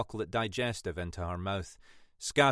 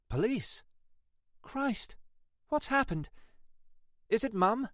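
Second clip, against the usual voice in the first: higher voice, growing worry; alarmed young woman; 5 semitones higher than usual. Acted. Parks, woken at night, alarmed by the detective's call. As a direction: wake up to a police call and panic.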